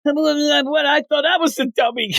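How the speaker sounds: high voice breaking into laughter; laughing character voice. Retold or imitated. Host quotes the henchman's line, laughing through it. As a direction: deliver the joke and crack up.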